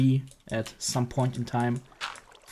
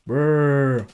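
zombie groan; 6 dB louder than usual. Pretend. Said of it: "I'm a better zombie than you are".

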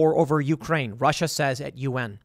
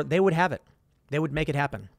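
ordinary delivery

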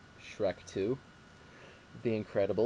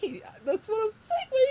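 surprised protest; 7 dB louder than usual. Retold or imitated. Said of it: "I was like".

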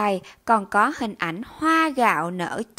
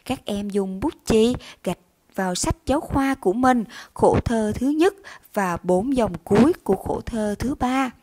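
ordinary delivery

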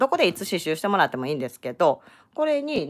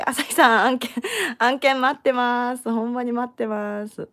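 higher, drawn-out plea; calling out; 6 semitones higher, 3 dB louder, slower than usual. Pretend. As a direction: call out hopefully to a sponsor. Calls out to sponsor for work. (高めに伸ばして呼びかける)

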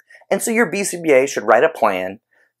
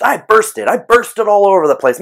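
louder and higher, a pretend meltdown; mock outburst; 8 semitones higher, 4 dB louder than usual. Teacher acting out an extinction burst, mock loud outburst. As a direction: burst out like a frustrated person dumping a purse.

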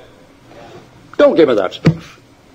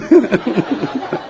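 hearty laugh; 4 semitones higher than usual. Real, not acted. Laughs at his own joke.

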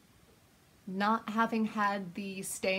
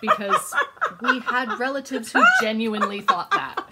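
laughing; 7 semitones higher, 12 dB louder than usual. Real, not acted. Laughing recalling funny relatives.

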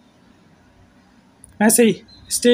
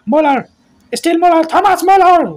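rising higher with each name; building excitement; 6 semitones higher than usual. Real, not acted. Commentator following Muller's run, growing excitement. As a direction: build up as the attack grows.

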